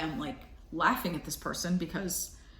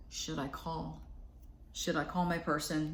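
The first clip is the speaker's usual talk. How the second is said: hushed, much softer inner doubt; anxious self-question; 2 semitones lower, 8 dB quieter than usual. Retold or imitated. Ex-partner's worried inner voice, softly. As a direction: murmur it like a worried private thought. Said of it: "they're thinking, like".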